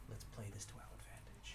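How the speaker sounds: very soft, quick plotting; conspiratorial whisper. Acted. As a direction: whisper the plan to your allies. Secret plotting with party.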